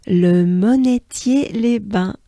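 "Le Monetier-les-Bains" said slowly in French; slow French town name. Shown as a demonstration. Teacher naming the next hard town. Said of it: "I know, I know".

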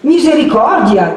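high, loud exclamation of dismay; startled shout; alarmed cry. A narrator's reading. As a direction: cry out in sudden fright. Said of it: "grida anche Agnese".

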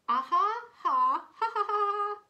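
high, very loud acted guffaw; hammed-up cartoon laughing; mocking crowd laughter. A narrator's reading. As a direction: belt out separate ha-ha bursts. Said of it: "laughed all the other animals".

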